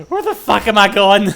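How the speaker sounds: loud, laughing outburst at getting lost; exasperated laughing yell. Real, not acted. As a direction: shout it, half laughing at yourself.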